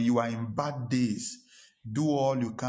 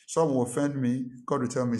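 usual speaking voice

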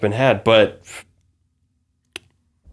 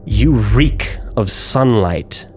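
menacing drow noble. Acted. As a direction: lay out each accusation like a sentence passed. GM voices drow woman.

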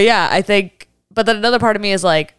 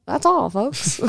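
Looney Tunes; 2 semitones higher, 4 dB quieter, slower than usual. Retold or imitated. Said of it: "the Looney Tunes".

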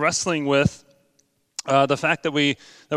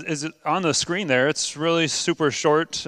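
ordinary delivery

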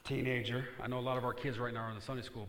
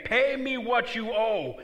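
loud, slow, high demand; furious servant demanding payment; 11 semitones higher, 13 dB louder, slower than usual. Acted. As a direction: bark it out like a creditor choking a debtor. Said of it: "took him by the throat, saying".

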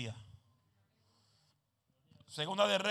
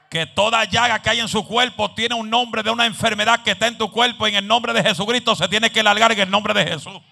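loud, driving faith declarations; full-force preaching; 6 semitones higher, 18 dB louder than usual. Real, not acted. Preacher declaring healing over the congregation, full-force preaching. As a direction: declare it loudly, building to a shout.

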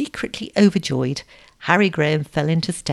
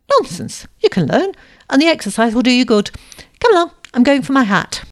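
loud, high, no-nonsense orders; brisk, bossy; 7 semitones higher, 6 dB louder than usual. A narrator's reading. Girl orders her suitor to come rowing, briskly. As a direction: overrule him briskly, voice raised.

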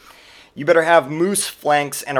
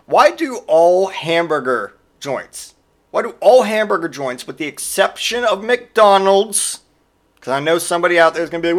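usual speaking voice

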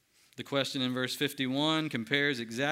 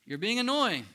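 annoyed crowd; 6 semitones higher, 4 dB louder than usual. Retold or imitated. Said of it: "other members of the crowd who are saying".

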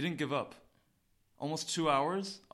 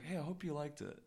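soft, easygoing imitation of a laid-back comic; calm; 2 semitones higher, 7 dB quieter than usual. Comedian greeting a fan while eating his apple, calm and soft. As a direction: quote him lightly and quietly, unfussed. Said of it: "He's really calm".

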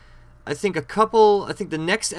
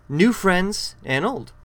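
title announcement; 5 semitones higher, 4 dB louder, much slower than usual. Acted.

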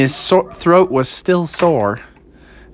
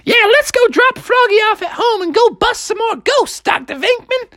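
high falsetto, eager cartoon mother; high-pitched keen mom; Froggy's mom. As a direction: gush in a squeaky mom voice. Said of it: "Froggy's mom was like".

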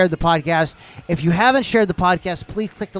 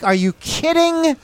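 incredulous; 9 semitones higher, slower than usual. Retold or imitated. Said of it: "I'm just like".

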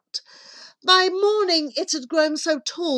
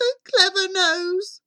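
put-on high, frightened boy's wail; gasped; 10 semitones higher, 4 dB louder than usual. A narrator's reading. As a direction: gasp it as a scared boy. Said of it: "he gasped".

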